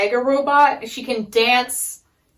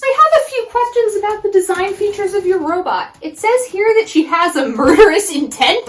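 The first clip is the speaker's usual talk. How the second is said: higher, louder, fussy businessman; prim investor voice; 9 semitones higher, 5 dB louder than usual. Retold or imitated. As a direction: read it like a nervous investor.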